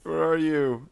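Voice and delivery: loud, high call. Real, not acted. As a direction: yell for someone absent. Calling out again.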